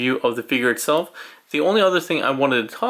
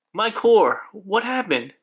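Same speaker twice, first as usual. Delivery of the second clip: high, startled and worried; shocked at what happened; alarmed cry; 9 semitones higher than usual. Acted. Character transformed.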